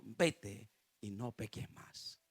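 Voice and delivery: soft, slow and hushed; gentle forgiving Jesus. Acted. Jesus gently sends the forgiven woman away, soft and slow. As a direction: let the pardon settle quietly.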